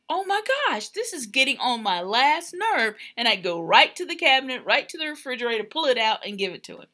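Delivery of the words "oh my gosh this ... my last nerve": retold exasperation, starting very high; exasperated. Wife recalling her husband not finding things, exasperated. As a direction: act out being fed up, pitch jumping up. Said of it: "And I was like".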